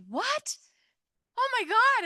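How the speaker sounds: high, loud cry of astonishment; half-reliving the moment she got the news; stunned disbelief. Retold or imitated. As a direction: gasp it out like you can't believe it. Retold reaction to the trip offer.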